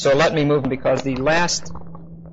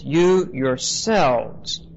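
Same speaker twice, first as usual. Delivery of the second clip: "You yourselves" spoken very slowly, each word weighted; very emphatic; much slower than usual. Shown as a demonstration. Preacher driving home the command to the congregation, "You yourselves" slow and emphatic. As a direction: drag out "You yourselves" with heavy stress. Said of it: "Very emphatic".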